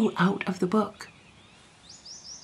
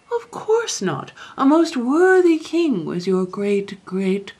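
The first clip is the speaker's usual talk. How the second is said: emphatic protest; 7 semitones higher, 5 dB louder, slower than usual. A narrator's reading. Chancellor protests the king's worth.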